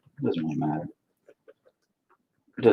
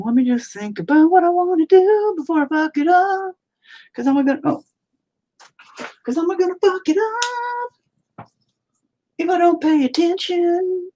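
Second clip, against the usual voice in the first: much higher put-on cartoon voice; silly high voice. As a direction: talk to himself in a squeaky character voice.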